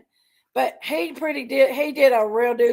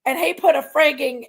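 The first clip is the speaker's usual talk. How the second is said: ordinary talk, nothing marked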